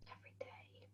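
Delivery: a breathless whispered echo of "Every day". Acted. Coach echoes her own tapping phrase in a soft whisper.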